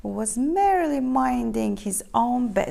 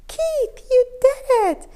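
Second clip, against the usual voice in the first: high, loud cheer; 11 semitones higher, 7 dB louder than usual. A narrator's reading. Cats cheering Keith for the rabbits. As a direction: cheer him on joyfully. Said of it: "the other cats cheered".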